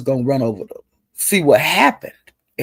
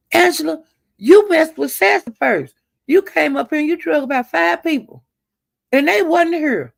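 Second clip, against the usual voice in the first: much higher voice, scolding; imitating Lemon scolding; 12 semitones higher than usual. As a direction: squeak it out like Lemon telling her off. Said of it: "Lemon say".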